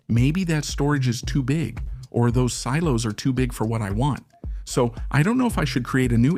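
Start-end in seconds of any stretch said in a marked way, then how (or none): none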